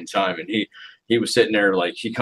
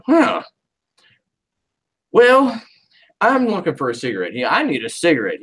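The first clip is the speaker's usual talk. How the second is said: Jim voice; 7 semitones higher than usual. Retold or imitated. Jim asking for a cigarette.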